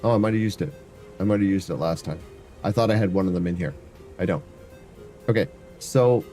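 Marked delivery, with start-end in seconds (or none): none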